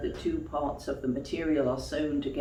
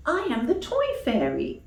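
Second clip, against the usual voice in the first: higher, tender fairy voice; gentle fairy; 10 semitones higher, 5 dB louder than usual. Acted. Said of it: "she said, kissing him gently".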